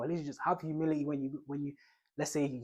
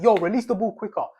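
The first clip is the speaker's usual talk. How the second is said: loud, higher call; football shout; 5 semitones higher, 12 dB louder than usual. Pretend. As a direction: bark the instruction across the pitch. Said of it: "you'd be like".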